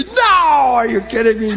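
high, loud, slow incredulous protest; stretched-out no, are you kidding me; mock disbelief. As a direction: ham up her disbelief.

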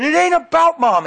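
high, loud retold teenage outburst; upset yell. Overwhelmed son pushing his dad away, imitated yell. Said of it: "screaming at me".

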